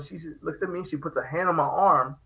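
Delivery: normal talking voice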